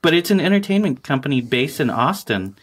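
smooth, upbeat sponsor read; mock ad read. Host reads a joke sponsor spot for the listeners, polished and upbeat. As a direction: sell it like a podcast ad spot.

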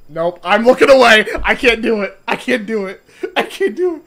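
loud terrified refusal; panicked yelling. Real, not acted. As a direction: shout it in rising panic. Panicking player refusing to look.

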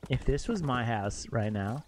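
normal talking voice

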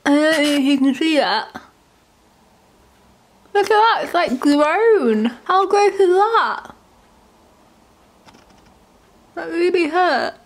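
high, squealing disgust at a bitten tongue; grossed out. Real, not acted. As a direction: show the bitten tongue, half laughing, half wincing. Just bit her tongue, showing it.

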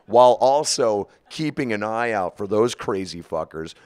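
ordinary talk

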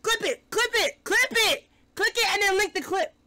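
high-pitched urgent chant; excited high calls. Real, not acted. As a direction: hype demanding clips. Excited calling for clips.

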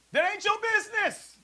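mock sassy comeback; sassy retort. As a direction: snap it back with attitude. Preacher retorting to disciples.

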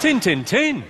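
loud, high Yorkshire "tin, tin, tin"; Yorkshire accent. Shown as a demonstration. Comic repeating the Yorkshire phrase for laughs, loud Yorkshire accent. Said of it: "My favourite Yorkshire phrase".

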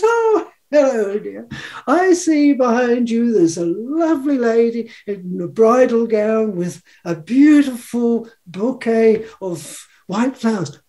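sweet medium voice. Retold or imitated. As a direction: coo it like a fortune-teller greeting a client. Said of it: "she says".

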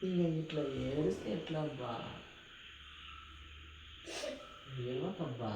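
soft, hushed comfort; soothing. Real, not acted. Husband hushing his crying wife, softly. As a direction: gently coax her to stop crying.

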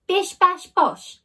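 high, loud chanted kicks; kicking sound effect. A narrator's reading. Narrator voicing the kicks on the door, high and loud. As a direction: bang out each kick.

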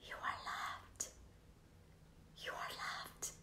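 soft, hushed "You are loved"; tender whisper. Real, not acted. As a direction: whisper it gently, as if holding his head. Comforting a man in distress.

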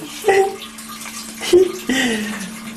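long high giggle; drawn-out, helpless giggles; real giggling. Real, not acted. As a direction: laugh at your own teasing. Filmer amused by own prank.

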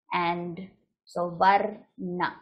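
Varna drawn out slowly, each syllable clear; slow demo of Varna. Shown as a demonstration. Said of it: "Varna is the pronunciation of syllables".

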